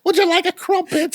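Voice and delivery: put-on high, prissy British accent offering a crumpet; hammed-up British tea-party accent; cartoon British accent, pitched high; mock posh British. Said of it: "You do a good one".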